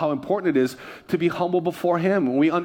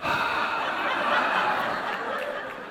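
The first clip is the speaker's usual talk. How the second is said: long, heavy breathy sigh; exasperated sigh; 5 dB quieter than usual. Retold or imitated. Pastor having a hard time in line, long exasperated sigh. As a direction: let out a long put-upon breath.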